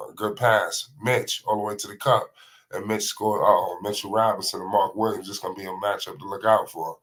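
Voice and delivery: quick, lively sportscaster call of the action; live play-by-play. Acted. Commentator calling LaMelo Ball's Garden matinee, lively play-by-play. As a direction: call every pass like a TV announcer.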